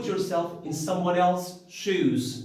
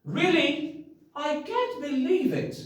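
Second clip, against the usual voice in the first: high, bright exclamation of disbelief; amazed disbelief; 11 semitones higher than usual. Shown as a demonstration. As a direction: sound truly astonished. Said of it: "I can't what?".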